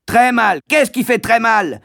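high, loud sneering repeat; sarcastic schoolmaster; mocking echo. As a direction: throw his words back. Master mocks.

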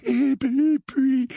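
high, quavering drawn-out words; trembling. A narrator's reading. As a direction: keep shuddering as you go on. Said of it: "répondit Dudley en tremblant". (voix aiguë et tremblante)

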